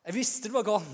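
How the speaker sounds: high, loud admission of guilt; cried-out confession. Acted. Sinner admitting they knew it was wrong, cried-out confession. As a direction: call it out for everyone to hear. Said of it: "så måtte vi ha ropt".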